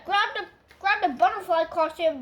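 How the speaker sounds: usual speaking voice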